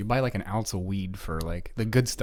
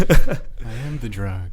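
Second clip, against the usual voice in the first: ominous voice; 8 dB louder, much slower than usual. Pretend. Ominous mock vision voice.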